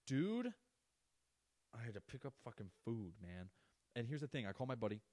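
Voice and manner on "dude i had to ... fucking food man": outraged at the job; exasperated dude. Real, not acted. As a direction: blurt it out in disbelief. Outraged about picking up food.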